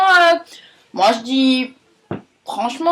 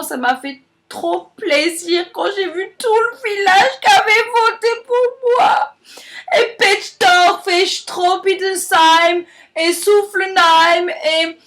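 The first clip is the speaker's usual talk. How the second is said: mock tearful outburst; 5 semitones higher, slower than usual. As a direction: choke up while thanking the village. Miss thanks village, tearful. (remerciements en pleurs exagérés)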